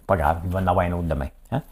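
ordinary talk, nothing marked

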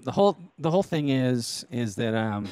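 ordinary talk

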